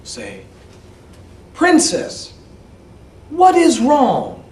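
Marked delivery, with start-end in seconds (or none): 1.6-4.4 high, loud, slow call. Acted. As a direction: call out to her slowly. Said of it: "she heard a voice say".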